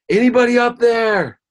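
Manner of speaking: high, loud holler for help; desperate scream. Acted. Man stuck on a cliff branch screams up for anyone, desperate shout. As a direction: call far up the cliff in a panicky shout. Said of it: "he looks up and he screams".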